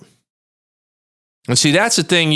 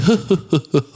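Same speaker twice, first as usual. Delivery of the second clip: light laugh; 6 semitones higher than usual. Real, not acted.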